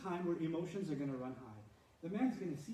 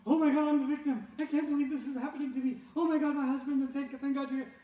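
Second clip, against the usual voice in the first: higher, much louder hammed-up panicked victim; mock victim; 8 semitones higher, 8 dB louder than usual. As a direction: wail like a wronged wife playing innocent. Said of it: "The switch to the victim".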